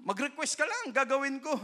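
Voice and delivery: God's easy offer, high pitched. Acted. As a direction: lift it up as an open invitation. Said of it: "quoting God".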